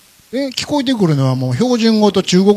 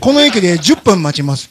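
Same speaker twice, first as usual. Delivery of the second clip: mock station announcement; 8 dB louder than usual. Imitated train announcement, retold.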